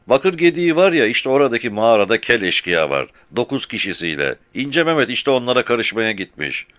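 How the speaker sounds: put-on young boy answering fast; shepherd boy. Frightened shepherd boy answering the captain, high child voice.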